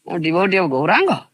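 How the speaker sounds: ordinary talk, nothing marked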